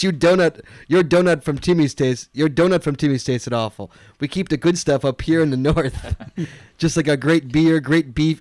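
mild put-on Canadian accent, a bit hammy. Reading a Canadian listener's email to cohost, mock Canadian accent. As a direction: read the email like a joking Canadian. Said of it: "your Canadian accent's worse than mine".

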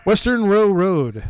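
slow, loud headline read; news read. Acted. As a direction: announce it like a newscaster.

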